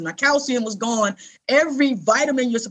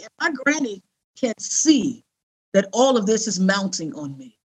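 usual speaking voice

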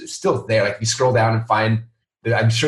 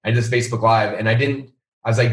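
normal talking voice

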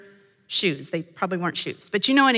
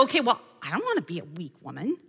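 softer, lower and fast, a quick mock self-talk; imitating a resolute woman; 5 semitones lower, 9 dB quieter, faster than usual. Woman pictured in the sermon making a quick private vow, softer, fast mock self-talk. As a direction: toss it off under your breath like a private vow.

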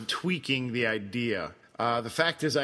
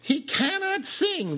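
much higher, gushing praise; 11 semitones higher than usual. Real, not acted. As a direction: build up the praise, then drop the punchline. Gushing then teasing about actor.

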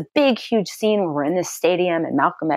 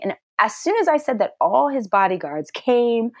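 normal talking voice

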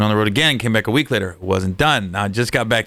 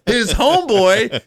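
usual speaking voice

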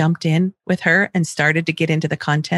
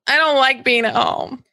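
put-on whiny child; 5 semitones higher than usual. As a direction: drag it out like a sulky kid. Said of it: "redirect as best I can".

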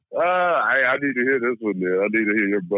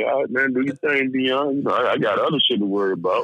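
dismissive inner voice; 6 semitones higher than usual. Retold or imitated. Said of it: "in my mind, I'm like".

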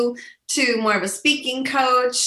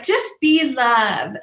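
slow mock serene; 3 semitones higher, slower than usual. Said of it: "So, like".

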